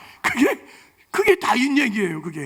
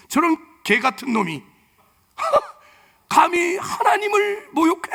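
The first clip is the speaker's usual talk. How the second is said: furious David; 8 semitones higher than usual. Retold or imitated. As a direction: spit out the insult in holy anger. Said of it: "신앙의 분노를 일으켰죠". (분노에 찬 다윗의 외침)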